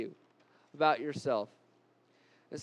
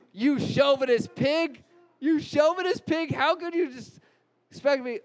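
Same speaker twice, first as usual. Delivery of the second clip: loud, high shout flung back; angry wife yelling back; 8 semitones higher, 4 dB louder than usual. Acted. Wife shouting back at her husband in the staged fight. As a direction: hurl the insult back at him. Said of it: "So I'm gonna demonstrate".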